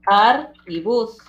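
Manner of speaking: "ar" and "bus" slowly, set apart; isolated syllables. Shown as a demonstration. Teacher naming the two closed syllables, "ar y bus" slowly separated. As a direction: give "ar", pause, then "bus". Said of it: "tenemos dos sílabas trabadas".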